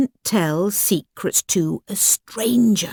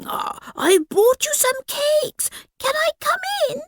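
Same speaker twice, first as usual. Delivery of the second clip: wolf's squeaky pretend girl; high fake voice. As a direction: keep up the high sweet disguise. Wolf keeps faking girl voice.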